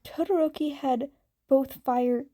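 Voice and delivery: usual speaking voice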